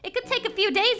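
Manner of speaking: ordinary delivery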